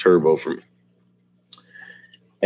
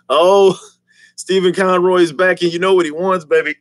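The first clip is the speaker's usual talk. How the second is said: excited; 9 semitones higher, 4 dB louder than usual. Real, not acted. Greets returning viewer excitedly.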